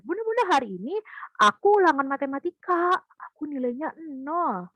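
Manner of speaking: put-on high eager child voice, slowing and fading at the end; child telling mum about the test; excited child. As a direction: blurt it out like a proud kid, then trail off. Child reports zero score.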